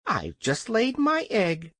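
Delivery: high, slow hen voice; defiant hen. A narrator's reading. Hen tells the King about her egg, defiant. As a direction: announce it high and slowly. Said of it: "replied Billina".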